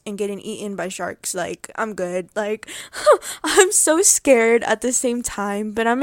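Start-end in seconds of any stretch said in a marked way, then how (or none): 2.7-3.4 giddy giggle. Real, not acted. Excited giggle about the cruise.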